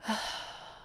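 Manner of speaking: long, soft exhaled sigh; reluctant sigh. Acted. Reluctant to keep talking.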